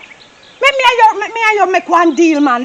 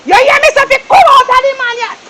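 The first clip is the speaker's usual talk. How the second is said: angry shout; 4 semitones higher, faster than usual. Acted. Man yelling at his lover to leave, furious shout. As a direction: order her to shut up and leave.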